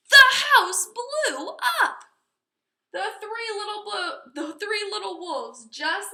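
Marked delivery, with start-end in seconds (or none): 0.1-2.0 much higher, louder, slower blast; explosive climax. A narrator's reading. Narrator telling the house exploding, loud and high. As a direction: make the explosion burst out.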